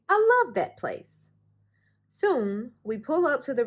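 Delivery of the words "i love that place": much higher, louder squeal of delight; boy thrilled to go to the dump, excited; excited child. A narrator's reading. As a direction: burst out with kid excitement.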